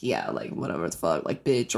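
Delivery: imitating bystanders muttering insults; snide muttered remarks. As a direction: toss off the insult under your breath. Quoting bystanders' snide comments.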